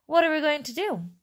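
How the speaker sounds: mild wail. A narrator's reading. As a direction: wail it in rising panic. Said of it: "wailed Missus Teeve".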